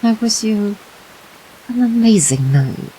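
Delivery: ordinary delivery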